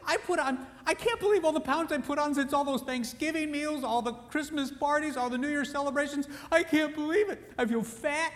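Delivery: high, mock distraught wife voice; upset wife. Wife wailing to her husband about the extra pounds. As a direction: wail about the scales like a dismayed wife. Wife lamenting weight gain.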